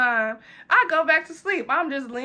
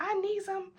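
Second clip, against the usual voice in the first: much higher pouting whine; whiny drunk refusal; 9 semitones higher, 6 dB quieter than usual. Retold or imitated. Answering the friend, high pouting drunk whine. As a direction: pout it like a tipsy child. Drunk self begging for ketchup.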